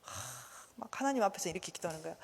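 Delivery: normal talking voice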